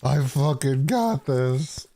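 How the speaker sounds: slow, drawn-out, leaning on got; hammed-up hero vow. Hero in the Mexico skit vowing, slow hammed-up resolve. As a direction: play the action hero, milking each word.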